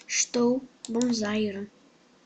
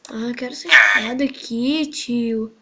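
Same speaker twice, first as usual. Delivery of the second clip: ordinary delivery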